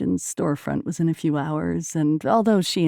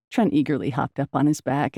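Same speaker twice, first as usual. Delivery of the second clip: ordinary delivery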